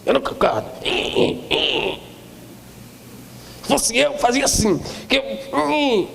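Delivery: high, sulky mock grumble; mock sulky complaint, pitched high; grudging disciple. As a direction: mimic a grudging disciple who disagrees. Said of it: "quase endemoniado de coração".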